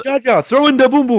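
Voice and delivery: very high, loud cartoon shout; Gungan battle cry. Gungan calling to Jar Jar in battle, high mock yell. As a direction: holler it as a goofy Gungan.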